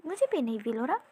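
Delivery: ordinary delivery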